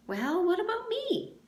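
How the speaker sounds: high mock-innocent protest; mock eager. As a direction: pretend to feel left out. Armpit joining the mockery.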